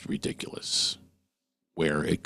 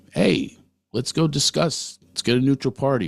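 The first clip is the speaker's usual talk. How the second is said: mock reasonable; 7 semitones higher, 6 dB louder than usual. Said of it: "An action to back it up would be".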